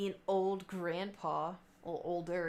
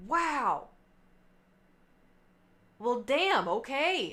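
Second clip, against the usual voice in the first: high, loud impressed reaction; mock astonished wow; retold amazement; 5 semitones higher, 6 dB louder, much slower than usual. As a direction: imitate a bartender stunned by an ID.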